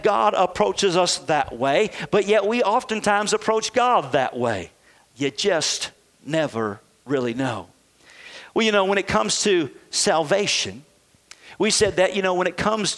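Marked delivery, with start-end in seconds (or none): 5.2-7.7 mock doubt. Mocking doubtful believer voice.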